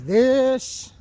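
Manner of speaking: normal talking voice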